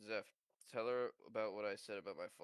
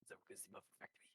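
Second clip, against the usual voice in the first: put-on very quiet French-accented quote; hushed, put-on French accent; 2 semitones lower, 14 dB quieter, much faster than usual. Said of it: "a little bit of French".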